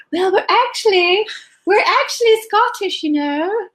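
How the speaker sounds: lightly imitating a proper little south of England child; mock south of England accent. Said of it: "we had a south of England accent".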